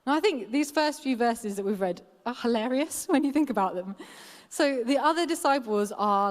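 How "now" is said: normal talking voice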